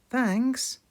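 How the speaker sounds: high little lamb voice; small child thanking; Susie voice. Acted. Susie replies.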